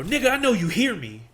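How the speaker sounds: loud, high-pitched angry yell; retold angry outburst. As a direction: yell it at someone who's ignoring you. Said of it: "I got mad now".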